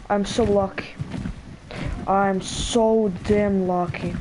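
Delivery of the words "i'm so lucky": ordinary delivery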